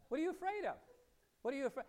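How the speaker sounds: high challenging call-out; high-pitched mock confrontation; confronting shout. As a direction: challenge the spirit head-on. Pastor confronting demon in story.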